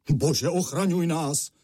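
slow, high-pitched dismayed exclamation; alarmed cry. A narrator's reading. Father as the floor caves in, crying out in alarm. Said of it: "zvolal otec".

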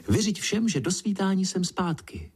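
high-pitched farewell call. A narrator's reading. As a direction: call it back on the way off. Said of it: "zavolala na mě".

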